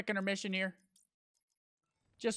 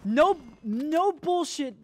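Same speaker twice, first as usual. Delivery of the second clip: 1 frustrated yell; 9 semitones higher, 6 dB louder, slower than usual. Real, not acted. Frustrated at own mistake.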